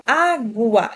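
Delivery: Água in syllables, "á-gu-a", U audible; deliberate Água with a distinct U; U clearly sounded. Shown as a demonstration. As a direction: stretch out the U in Água. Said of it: "Eu falo esse U".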